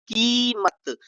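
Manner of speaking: "कीमत" with a long, high "की"; stretched first vowel. Shown as a demonstration. Teacher showing the pull in a word, long-vowelled "कीमत". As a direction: hold the "की" of "कीमत" before finishing. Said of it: "खींचा जा रहा है भाई".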